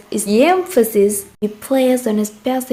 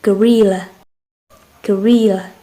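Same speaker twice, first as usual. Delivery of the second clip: "Gorilla" with RIL read higher and louder; stress on second syllable; 3 dB louder than usual. Shown as a demonstration. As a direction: punch the middle syllable of "Gorilla". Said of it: "higher and louder".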